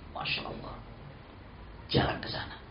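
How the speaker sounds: hushed whisper; whispered aside. Acted. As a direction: breathe it as an awed aside. Unvoiced hush in story.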